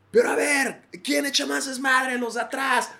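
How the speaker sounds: host hyping the audience at a concert; crowd hype. Retold or imitated. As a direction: whip up the crowd section by section.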